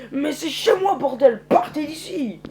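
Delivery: high, loud, indignant yell; angry outburst. Acted. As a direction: drive intruders off your land, stretching the words out. Farmer orders strangers off his land.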